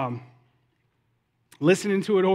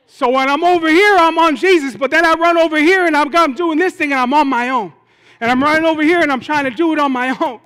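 loud, higher, driving sermon delivery; impassioned preaching; 6 semitones higher, 9 dB louder than usual. Real, not acted. Preacher likening his life to a house, impassioned. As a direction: build the list with mounting force.

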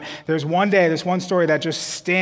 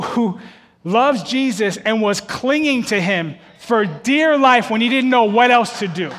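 usual speaking voice

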